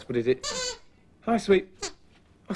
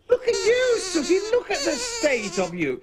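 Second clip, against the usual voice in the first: shocked; 12 semitones higher than usual. Acted. [name] scolding puppets, shocked.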